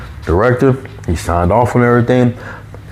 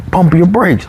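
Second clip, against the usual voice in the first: high-pitched imitation of a boss calming someone; imitating a "relax, we got you" boss; retold boss reassurance; 10 semitones higher than usual. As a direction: talk him down, pitched well up. Retold boss telling him to relax.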